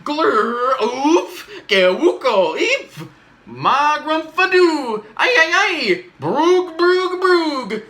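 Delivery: high, loud goofy gibberish; silly nonsense sounds. Acted. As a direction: blurt out wacky noises with abandon. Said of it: "make silly sounds like".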